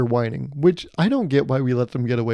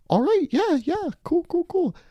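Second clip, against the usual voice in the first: squeaky high, breezy "cool, cool"; corporate yes-man. Retold or imitated. Comic mocking a coworker closing the meeting, squeaky high. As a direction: toss it off like a falsetto office drone. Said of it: "people that talk like that".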